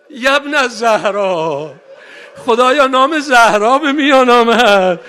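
3 wail the tale to the mourners; 4 full-voiced rawzeh lament; 1 lamenting sermon.

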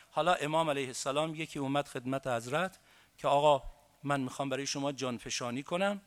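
soft, grieving storyteller's voice for the martyrdom tale; hushed grieving recital; hushed mourning narration. Acted. Lament narration of Ashura.

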